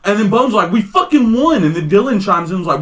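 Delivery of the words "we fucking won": imitating an indignant teammate, pitched high; retold high-pitched protest. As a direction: insist on it, voice jumping up.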